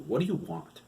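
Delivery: "What do you want?" asked again, short and impatient. Acted. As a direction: hurry him along. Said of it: "I have work to do".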